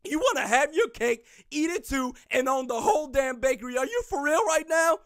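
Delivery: ordinary delivery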